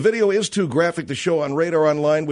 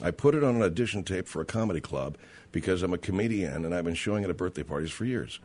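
quoted words repeated very low and soft; low under-the-breath repeat; 10 semitones lower, 9 dB quieter, faster than usual. Retold or imitated. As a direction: drop right down, almost to yourself.

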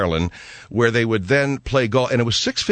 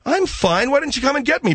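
indignant protest; 13 semitones higher than usual. Acted. Host protests from bathroom to sidekick.

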